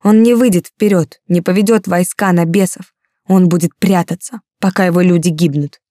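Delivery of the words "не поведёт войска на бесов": plain reading voice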